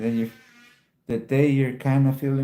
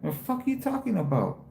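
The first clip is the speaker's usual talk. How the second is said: raised, scornful what-are-you-talking-about; incredulous retort; 6 semitones higher than usual. Retold or imitated. To the lady asking if he was special ed, scornful. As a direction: snap back at the lady, pitch up. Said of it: "I told her like that".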